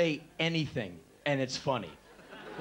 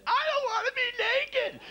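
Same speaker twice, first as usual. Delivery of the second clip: very high, whiny squeal of panic; the scared ten percent leaking out; squeaky mock terror in his head; panicky whimper. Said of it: "ten percent".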